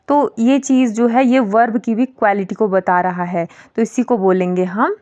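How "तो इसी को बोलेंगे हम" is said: normal talking voice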